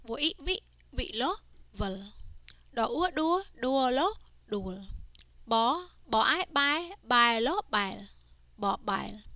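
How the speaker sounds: teacher's sing-song spell-and-read drill. Shown as a demonstration. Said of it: "read each word by first saying the consonant".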